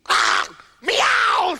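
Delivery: high loud yowling meow; startled cat yowling at the hunter; angry cat yowl. Acted. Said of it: "said the cat".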